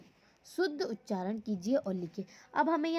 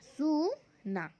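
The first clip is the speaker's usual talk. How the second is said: slowly drawn out; 3 semitones higher, much slower than usual. Shown as a demonstration. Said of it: "अब हम आगे देखेंगे".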